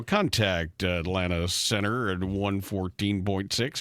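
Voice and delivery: low, slow air traffic radio call; pilot cockpit headset. Retold or imitated. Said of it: "you get to hear all the jargon".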